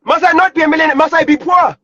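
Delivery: ordinary talk, nothing marked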